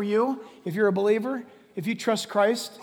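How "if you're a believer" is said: usual speaking voice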